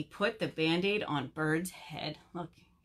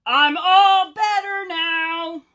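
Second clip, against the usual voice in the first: high, drawn-out shout; storybook bird yelling to be heard; 8 semitones higher, 11 dB louder, slower than usual. A narrator's reading. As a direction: holler it slowly, building to full volume. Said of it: "shouted Bird".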